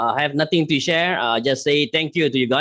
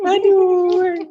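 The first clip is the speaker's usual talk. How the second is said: laughing; 5 dB louder than usual. Real, not acted. Groan after laughing fit.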